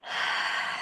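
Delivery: long soft exhaled breath; deep breath out. Shown as a demonstration. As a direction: breathe out slowly and let go. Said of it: "take a deep breath".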